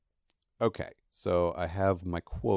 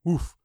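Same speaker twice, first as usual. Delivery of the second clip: bark; 8 semitones higher, 5 dB louder than usual. Acted. Laddie barks in story.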